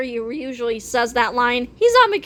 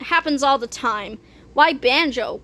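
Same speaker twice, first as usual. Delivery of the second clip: ordinary delivery, nothing marked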